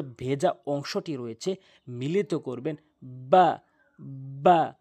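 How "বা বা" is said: বা said loud with both lips pressed together; letter demonstration. Shown as a demonstration. Teacher demonstrating the letter to students, loud and exaggerated. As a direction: pop বা out through the lips. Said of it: "বা, এভাবে উচ্চারণ করবেন".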